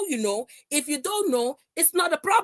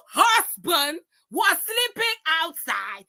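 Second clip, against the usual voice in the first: furious tearful yell; 2 semitones higher, slower than usual. Real, not acted. Streamer cries out the accusation at her rival. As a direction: drag it out through tears of rage.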